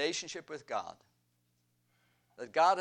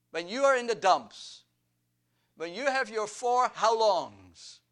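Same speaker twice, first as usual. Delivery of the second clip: rousing exhortation; 5 semitones higher, 4 dB louder than usual. Acted. Preacher exhorts congregation.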